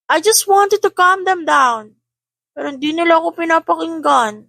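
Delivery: put-on a cross older boy snapping at his siblings; annoyed child voice. A narrator's reading. Cassian scolding siblings: high, annoyed child voice.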